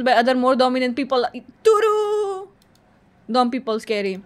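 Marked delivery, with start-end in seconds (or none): 1.6-2.5 high, sustained sing-out answer; drawn-out verdict. Pretend. Answering a personality quiz, high and drawn-out. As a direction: stretch the vowel on one held note.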